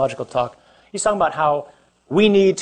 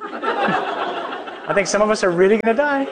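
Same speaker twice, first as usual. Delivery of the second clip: laughing; 6 semitones higher, slower than usual. Real, not acted. Laughing at his own joke.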